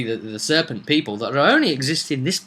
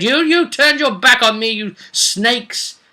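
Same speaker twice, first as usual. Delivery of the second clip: higher, grand scolding serpent prophet; hammed-up doom-laden warning; Costello's prophecy; 7 semitones higher, 3 dB louder than usual. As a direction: condemn the snake people from on high. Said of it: "who said".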